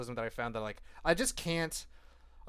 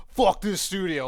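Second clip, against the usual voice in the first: loud, higher mock-angry outburst; mock outrage; 5 semitones higher, 9 dB louder than usual. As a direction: imitate a trade turning on its partner, loudly. Imitates trades turning on studios.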